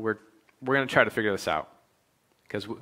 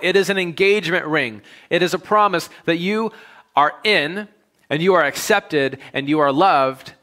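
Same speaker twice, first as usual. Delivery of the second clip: rising, louder preacher's build, slowing; preaching at full force; 6 semitones higher, 6 dB louder than usual. Real, not acted. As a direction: preach it with swelling conviction.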